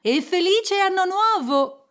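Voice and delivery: high, loud New Year's cry; parting shout. A narrator's reading. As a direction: call it out cheerily while leaving. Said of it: "gridò Fred uscendo". (augurio gridato uscendo)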